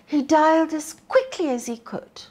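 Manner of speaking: ordinary delivery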